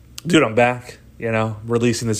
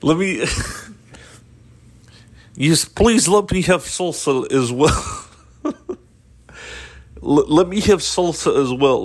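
higher mock Russian accent, amused; 4 semitones higher than usual. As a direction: politely order in a put-on Russian accent. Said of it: "in a Russian accent now".

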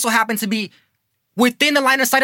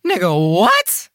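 slow, stunned "what?"; can't believe what he heard; stunned disbelief; 3 dB louder, slower than usual. Real, not acted. Reacting to shocking news.

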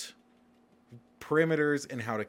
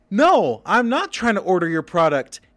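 loud, high exasperated rant; 10 semitones higher, 7 dB louder than usual. Retold or imitated. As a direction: vent at the dumb website. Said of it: "And I'm like".

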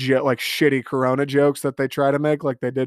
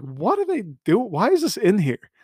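higher mock-baffled asking; 7 semitones higher than usual. Viewer on the couch, mock baffled disbelief. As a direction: act stunned by the scene. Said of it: "I'm like".

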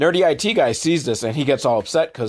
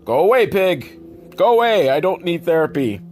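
higher, mildly pleading send-off; mock shooing; 5 semitones higher than usual. [name] to the therapy pig following him, mock exasperated shooing. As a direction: lightly act out shooing a pest.

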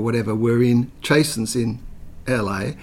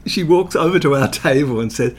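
ordinary talk, nothing marked

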